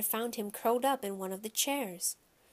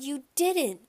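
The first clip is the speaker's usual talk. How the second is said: higher, louder motherly disbelief; raised voice of a shocked mom; dismayed cry; 5 semitones higher, 5 dB louder than usual. A narrator's reading. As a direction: exclaim it, appalled at a child. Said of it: "my mother cried".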